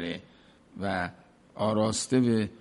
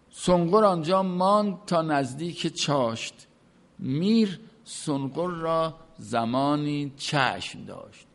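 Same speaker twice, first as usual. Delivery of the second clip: poem recitation; 4 semitones higher than usual. Acted. Reciting Masnavi verse.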